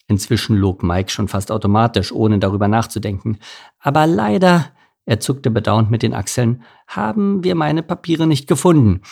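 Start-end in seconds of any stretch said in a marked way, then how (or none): 3.8-4.7 feigned regret. A narrator's reading. Mike feigns regret to policeman. 6.9-9.0 high-pitched, regretful fib; feigned regret. A narrator's reading. Mike lying to the officer about lost papers, regretful.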